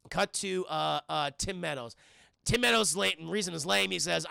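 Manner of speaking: ordinary delivery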